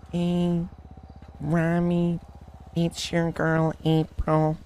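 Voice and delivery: teasing imitation of a sweet-talking girl; April impression. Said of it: "is this your impression".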